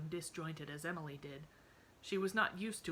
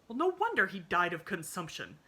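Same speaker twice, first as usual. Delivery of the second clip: harsh retort; 2 semitones higher, 8 dB louder than usual. A narrator's reading.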